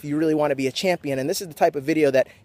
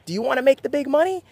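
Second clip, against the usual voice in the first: usual speaking voice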